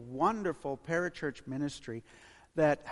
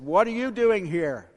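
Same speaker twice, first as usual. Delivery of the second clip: loud, higher demand of an intruder; confronting shout; 6 semitones higher, 12 dB louder than usual. Acted. Huge Ugandan confronts missionary in the room, loud demand. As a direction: challenge the stranger forcefully, voice raised.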